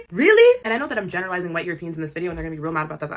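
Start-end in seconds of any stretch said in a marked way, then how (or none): 0.1-0.6 much higher, louder, sharply rising "Really?"; challenging disbelief. Real, not acted. Challenging Europeans on their history, a loud disbelieving question. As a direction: throw the question back in disbelief.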